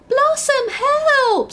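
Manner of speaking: high, loud cry for help; scream. A narrator's reading. Ladybird screams to the butterfly for help. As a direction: shriek her name in panic. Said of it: "screamed Lenny the ladybird".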